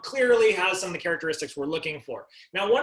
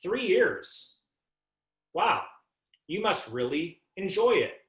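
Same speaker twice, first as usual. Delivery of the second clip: "Three years" echoed high, then "you must really enjoy it" slowed down; a slow, impressed statement that invites an answer on "enjoy it"; questioning inflection on a statement; 4 semitones higher, slower than usual. Shown as a demonstration. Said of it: "Listen to my voice inflection".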